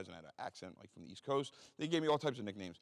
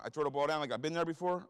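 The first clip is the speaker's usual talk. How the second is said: plain reading voice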